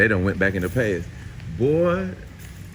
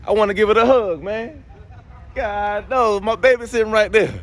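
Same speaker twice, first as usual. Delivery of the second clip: higher and louder, hammed-up wailing over the car; mock lament; 9 semitones higher, 5 dB louder than usual. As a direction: wail like a heartbroken parent, half joking. Car owner mock-grieving car in shop.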